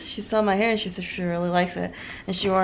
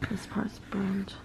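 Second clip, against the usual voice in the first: hushed whisper; 14 dB quieter, slower than usual. Real, not acted. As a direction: say it under your breath. Whispering about burned pizza.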